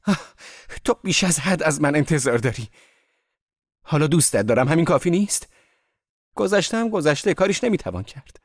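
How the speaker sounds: high, loud outburst of reproach; distraught shouting. A narrator's reading. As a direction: cry out at them, voice pitched right up. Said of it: "دیزی سر گتسبی فریاد کشید". (فریاد بلند و پراعتراض)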